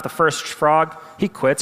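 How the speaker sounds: ordinary delivery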